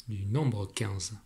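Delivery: normal talking voice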